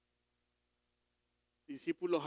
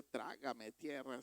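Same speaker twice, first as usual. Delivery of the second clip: embarrassed; 4 semitones lower, 7 dB quieter, slower than usual. Retold or imitated. Embarrassed before a visitor.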